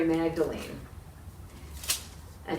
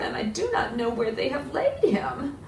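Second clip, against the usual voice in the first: whispered grief; 6 semitones higher than usual. Acted. Mary to the gardener, whispering in grief. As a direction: whisper it, all breath gone. Mary's plea fades.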